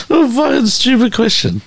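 ordinary delivery

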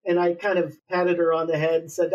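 ordinary talk, nothing marked

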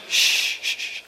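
soft whispered shush. Real, not acted. Youth speaker hushing a noisy audience, whispered shush. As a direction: hush the room down.

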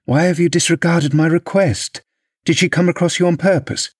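high, insistent demand for an answer; vexed questioning. A narrator's reading. Mistress demanding why he disregarded her request, vexed. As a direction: press him with indignant questions.

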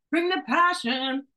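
rally the listener, slow and rising; fired-up rally call. Shown as a demonstration.

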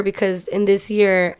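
ordinary talk

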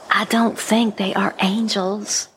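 slow whispered aside; whispering. Acted. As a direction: whisper it so they can't hear. Aside hidden from strangers.

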